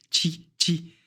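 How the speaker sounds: "chi" as tchi, T leaning into ch; pressed T. Shown as a demonstration. Teacher showing how to lean on T, "chi" as tchi. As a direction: press a little on the T in each "chi". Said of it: "On appuie un peu sur le T".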